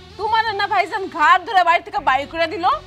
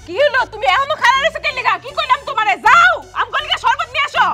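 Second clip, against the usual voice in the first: high, irritated ordering about; impatient command; 6 semitones higher than usual. Acted. Guest demands her sherbet now, impatient and angry. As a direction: order them off sharply, pitch climbing.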